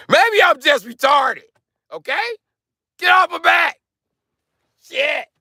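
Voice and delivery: very high, loud comic shriek; sudden screaming. Acted. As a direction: burst into a high-pitched screamed protest. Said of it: "Kinda just start screaming at any given moment".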